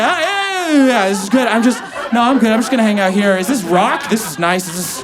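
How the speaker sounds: high, loud, hammed-up panic settling down; flustered cover-up. Groom caught teary-eyed by his dad, flustered cover-up. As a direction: blurt it like you've been caught, feigning casual.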